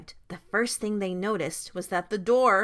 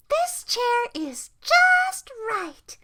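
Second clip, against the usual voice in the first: put-on high, very slow, louder little-girl voice; delighted Goldilocks; 13 semitones higher, 5 dB louder, much slower than usual. A narrator's reading. Goldilocks settling into Baby Bear's chair, delighted. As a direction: draw it out high with satisfaction.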